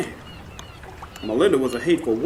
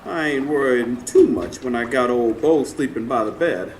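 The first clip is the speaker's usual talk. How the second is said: ordinary talk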